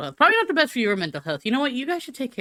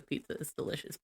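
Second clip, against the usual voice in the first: whispered aside; 5 semitones lower, 15 dB quieter than usual. Real, not acted. Whispered aside about pizza.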